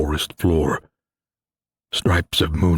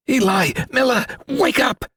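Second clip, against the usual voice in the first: high, panicked urgent call; frantic wake-up plea, pitched up high; urgent hiss. A narrator's reading. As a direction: shake them awake in terror. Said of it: "Xavier hissed".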